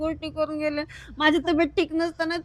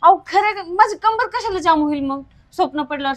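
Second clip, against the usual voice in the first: heated insisting; 4 semitones higher, 7 dB louder than usual. Acted. Wife insisting angrily.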